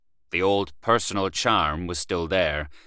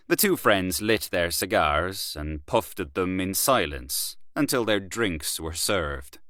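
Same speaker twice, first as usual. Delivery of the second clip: usual speaking voice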